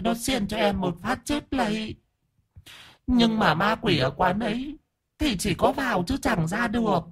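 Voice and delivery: high, soft falsetto lament; plaintive ghost hostess. Acted. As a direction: recount your misfortunes in a thin, aggrieved high voice. Ghost tells her sad death story. (giọng ma cao, khẽ, kể lể than thân)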